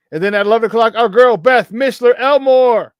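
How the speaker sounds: announcing the guest with fanfare; hype intro. Real, not acted. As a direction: build it up like an emcee.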